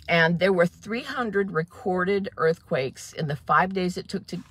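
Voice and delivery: normal talking voice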